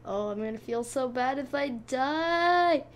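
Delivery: usual speaking voice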